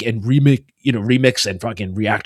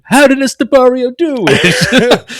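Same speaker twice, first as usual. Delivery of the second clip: put-on slow, high-pitched Italian-accented amazement; hammed-up Italian disbelief, breaking into a laugh; mock Italian reactor; 11 semitones higher, 5 dB louder than usual. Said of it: "a reaction video of you going".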